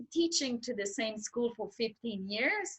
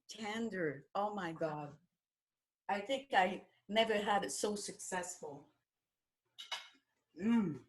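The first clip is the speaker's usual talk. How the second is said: soft, mouth-full murmur of delight; soft savouring; 9 dB quieter than usual. Real, not acted. Guest praises the tender chicken while eating, softly. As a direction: murmur as if still chewing.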